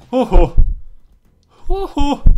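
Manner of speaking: very high, loud whooped huhhuh; loud hooting phew. Real, not acted. Player whoops after a close call, hooting. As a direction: hoot out exaggerated relief. Relief after a near mishap.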